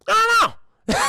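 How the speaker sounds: very high, loud silly voice breaking into a laugh; giggly confused granny. Acted. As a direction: play the dotty old lady, then cackle. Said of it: "She's like".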